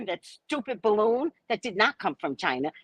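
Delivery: ordinary delivery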